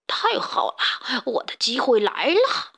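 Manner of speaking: slow, gloating villain's aside; secretly delighted. A narrator's reading. Stray pig watching the henhouse quarrel, secretly delighted. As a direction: savour the lucky chance, drawn out. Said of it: "他心里暗暗欢喜".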